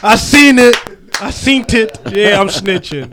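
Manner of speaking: loud, high, laughing call-out; excited teasing. Real, not acted. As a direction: laugh while busting him out. Teasing friend about lens cap.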